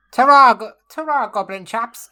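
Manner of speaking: high, loud ta-ra; cheery farewell call. Acted. Krill calls goodbye to the goblins, high and loud. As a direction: sing out a hearty goodbye.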